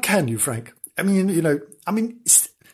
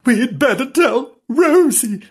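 high, shaky frightened voice; trembling voice; 10 semitones higher, 5 dB louder than usual. Acted. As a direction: quaver with fear. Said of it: "in a trembling voice".

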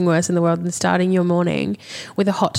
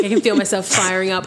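ordinary talk

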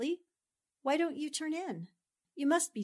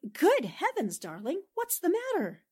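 sudden worried surprise, rising high; startled concern; 7 semitones higher than usual. Acted. Wife startled by her husband's dazed look, alarmed. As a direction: catch your breath at his strange face.

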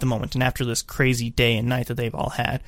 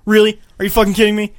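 mock outraged; 6 semitones higher, 7 dB louder, faster than usual. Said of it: "He's like".

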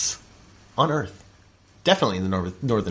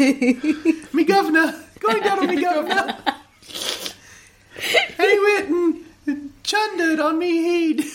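mock British accent; 3 semitones higher than usual. Co-host retelling a vomit story in mock British. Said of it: "British accent".

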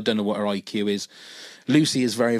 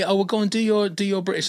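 higher, encouraging coax; coaxing friend; 9 semitones higher, faster than usual. Retold or imitated. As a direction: egg them on. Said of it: "But when I say".

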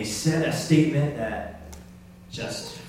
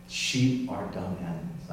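softer, lower repeat of the quote; subdued repeat; 5 semitones lower, 7 dB quieter than usual. Retold or imitated. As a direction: let the quote settle, low and soft. Repeats the quote more quietly.

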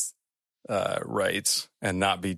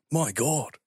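higher-pitched Aussie exclamation; broad Australian accent, in character; 6 semitones higher than usual. Acted. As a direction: gasp it out as an Aussie. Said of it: "haven't done an Australian accent".

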